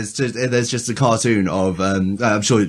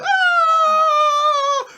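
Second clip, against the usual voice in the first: long very high mock scream; thin falsetto wail, held as they fall; falling leaves' scream; 3 dB louder than usual. Two leaves drop from the tree, high mock scream. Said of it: "two leaves kind of detach, go".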